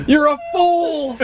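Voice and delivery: high, drawn-out silly jeer; mocking townsfolk. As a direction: heckle in a hammed-up villager's voice, laughing. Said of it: "there's, like, townsfolk".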